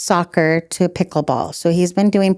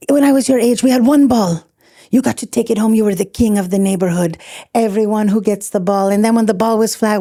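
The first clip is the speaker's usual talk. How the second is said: put-on Luca's Tunisian accent; 4 semitones higher than usual. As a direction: lecture like a Tunisian father, hamming up the accent. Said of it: "he knows I do his accent".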